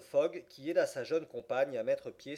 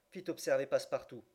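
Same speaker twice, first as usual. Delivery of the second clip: usual speaking voice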